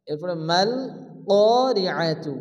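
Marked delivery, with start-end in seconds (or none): 1.3-2.3 "কারিআতু" slowly, the alif held long; slow, careful "কারিআতু"; tajweed demo. Shown as a demonstration. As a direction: stretch the alif of "কারিআতু" for one alif length. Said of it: "এখানেও ক আলিফ জবর ক".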